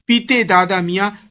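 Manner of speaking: ordinary delivery, nothing marked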